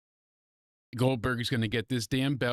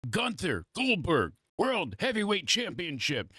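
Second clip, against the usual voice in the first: announcer voice; 7 semitones higher than usual. Retold or imitated. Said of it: "they announced it".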